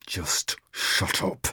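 slow furious whisper; through gritted teeth. Acted. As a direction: grit it out under your breath. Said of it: "he gritted".

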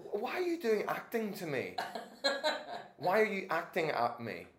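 high, loud and laughing retort; incredulous protest. Retold or imitated. Retold incredulous protest through laughter.

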